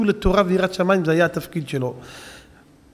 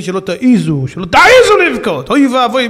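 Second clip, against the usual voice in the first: building to a loud high command; stern warning; 4 semitones higher, 7 dB louder than usual. Retold or imitated. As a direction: forbid them sharply. Rashbi forbidding weeping.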